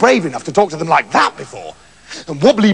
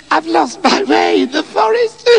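high, whiny lost-man voice; lost, whimpering man; 9 semitones higher than usual. Acted. The lost man tells Trousers he's lost, whiny. The man's voice, higher.

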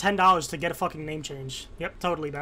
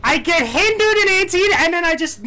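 high, loud protest; exasperated rant; 8 semitones higher, 6 dB louder than usual. Real, not acted. Gamer complaining he got hindered, exasperated yelling. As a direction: complain at the top of your voice. Complaint about being shut down.

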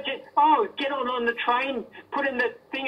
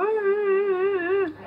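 higher mock old man's ooh; mock moaning; 7 semitones higher, much slower than usual. Caller mocking the host as feeble, higher mock ooh sounds. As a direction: whimper it like a frail old man. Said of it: "You go".

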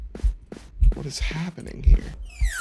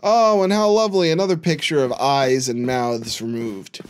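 ordinary talk, nothing marked

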